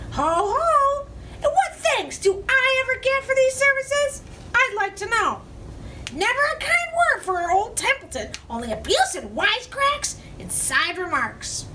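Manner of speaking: high, wailing rat complaint; self-pitying rat. Acted. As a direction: whine about never being thanked.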